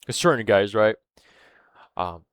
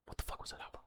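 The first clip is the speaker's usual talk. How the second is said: whispered; 25 dB quieter, much faster than usual. Real, not acted. Vlogger losing his thread, whispering to himself. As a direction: whisper it, much softer. Lost his train of thought.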